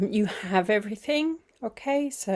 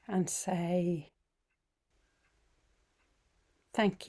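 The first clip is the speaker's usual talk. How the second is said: guided meditation; 5 dB quieter than usual. Acted. Teacher easing the group into feeling the body, guided meditation. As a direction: guide them gently through it, phrase by unhurried phrase.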